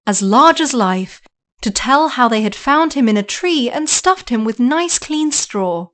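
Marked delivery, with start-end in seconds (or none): none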